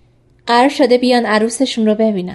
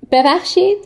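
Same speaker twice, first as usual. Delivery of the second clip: higher, questioning pardon; taken aback; 8 semitones higher than usual. A narrator's reading. Woman asked about her looks after marriage, taken aback. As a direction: say it as a startled question, pitched up.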